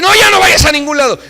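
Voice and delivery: quick, rising, pleading wife; high, fast pleading; wife's plea. Retold or imitated. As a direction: beg him not to go anywhere.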